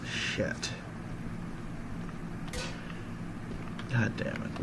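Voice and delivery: under-the-breath frustrated curse; muttered curse. Real, not acted. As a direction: mutter the curse softly to yourself. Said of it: "frustrated at forgetting".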